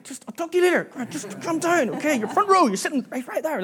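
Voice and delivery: high-pitched imitation of a parent reining in a child; high, pleading mock-parent voice; flustered parent. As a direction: act out trying to calm your kid down. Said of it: "And I'm like".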